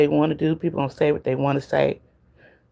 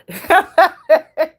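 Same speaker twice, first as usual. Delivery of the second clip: a big burst of high laughter; loud squealing laugh; loud high cackle; 12 semitones higher, 6 dB louder than usual. Real, not acted. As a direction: crack up loudly at the story.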